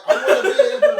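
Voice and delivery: laughing. Real, not acted. Laughing mid-sentence.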